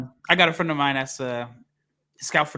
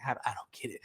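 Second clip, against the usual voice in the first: much softer, whispered confusion; baffled whisper; 4 semitones lower, 12 dB quieter, faster than usual. Retold or imitated. Host reacting to varying name pronunciations, baffled whispered aside.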